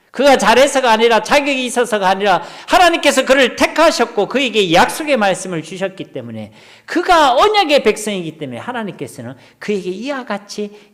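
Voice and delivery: high, loud, insistent preaching; preacher pounding out each clause; fervent preaching. Acted. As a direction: drive the point home from the pulpit. Preaching at full force.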